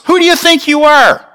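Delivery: indignant challenge. Acted. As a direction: demand it like scolding a presumptuous child. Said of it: "statement we would make to somebody".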